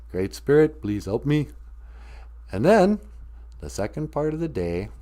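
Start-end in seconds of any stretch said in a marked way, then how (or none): none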